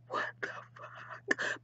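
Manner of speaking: slow, voiceless whisper; stunned whisper. Acted. Duchess after the phone hangs up, stunned whisper. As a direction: breathe it out in slow disbelief.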